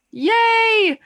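high, bright little cheer; excited cheer. Real, not acted. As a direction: squeal it with delight. Cheering about coach joining.

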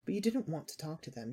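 usual speaking voice

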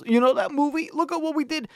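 sneering mimic of the filmmaker; mock proud filmmaker. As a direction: gloat like a smug director showing off. Mocking the movie's proud filmmakers.

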